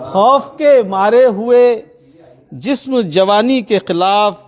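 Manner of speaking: measured Urdu poetry recitation; tender romantic poetry. Acted. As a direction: recite each couplet with feeling. Poet reciting at mushaira.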